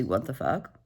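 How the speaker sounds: quiet stunned what the fuck; stunned low mutter. Real, not acted. Repeated reaction, quieter take.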